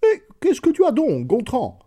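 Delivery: wife calling to her husband through the wall. A narrator's reading. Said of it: "cria à travers le mur".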